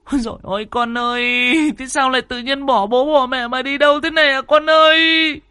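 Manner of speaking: high, loud wailing cries for her son; wailing mother. A narrator's reading. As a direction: wail for the lost child at the top of your voice. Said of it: "bà Chỉ bù lu bù loa". (giọng mẹ gào khóc gọi con, cao và to)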